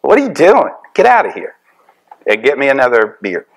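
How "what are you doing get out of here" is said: ordinary talk, nothing marked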